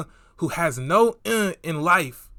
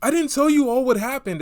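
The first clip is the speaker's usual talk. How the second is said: higher, louder whiny mimicry; higher mock of an excuse; mocking quote; 8 semitones higher, 5 dB louder than usual. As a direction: parody the excuse. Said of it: "when people say that".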